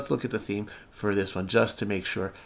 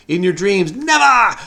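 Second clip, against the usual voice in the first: higher, louder defiant taunt; mock taunt; 7 semitones higher, 6 dB louder than usual. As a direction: fling it back defiantly.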